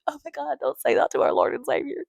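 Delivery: high scandalized church voice; mock shocked believer. Believers scolding her blasphemy, high mock shock. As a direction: play the horrified pious person. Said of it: "I know people are like".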